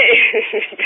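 a short sheepish laugh; embarrassed laugh. Real, not acted. Operator to caller, sheepish real laugh. Operator apologising, can't understand.

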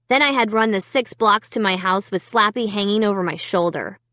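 plain reading voice

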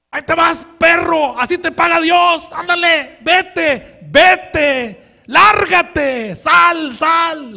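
loud, high, furious yelling at the king; screaming curses at a fleeing king; furious shout. Acted. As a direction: drive him off with screamed insults. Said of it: "y empieza a gritar".

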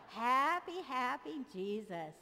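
normal talking voice

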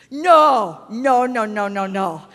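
loud start fading off; emphatic protest. Retold or imitated. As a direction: refuse it, fading out. Retold protest to pastor.

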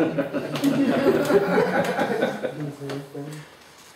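loud laugh at the punchline; hearty laugh. Real, not acted. Laughing at own story.

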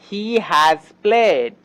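very slow, stretched out; slow model sentence. Shown as a demonstration. Teacher slowly modelling the English example. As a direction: lay each word down for learners. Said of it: "যেমন".

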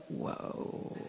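hushed, breath-only whoa; barely voiced amazement; awestruck whoa. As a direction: breathe it out in tired wonder. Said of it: "like that".

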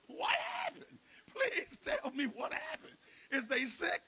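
high, crying plea for news; husband pleading with upset wife, high and tearful; panicked pleading. Retold or imitated. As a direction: beg to know what happened, voice cracking.

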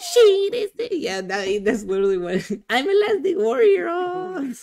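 high, wailing mock sob; imitating a crying clip for friends, high wail. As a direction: wail the quote like a crying fan.

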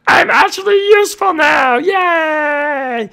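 high, loud, happy cheer; excited cheer. Acted. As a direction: cheer with delight. Happy about being useful.

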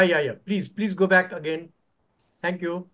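ordinary talk, nothing marked

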